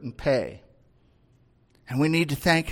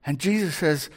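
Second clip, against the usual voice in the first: usual speaking voice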